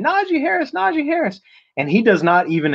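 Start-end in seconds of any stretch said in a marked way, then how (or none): 0.0-1.4 much higher screeching call of his name; screeching woman. Retold or imitated. Woman chasing player to the bus, screeching his name. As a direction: shriek his name after him from behind. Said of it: "like, just yelling".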